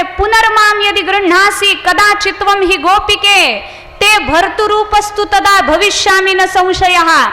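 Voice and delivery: slow, measured Sanskrit chanting; verse recitation. Acted. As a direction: recite the shloka solemnly and slowly. (संथ श्लोक पठण)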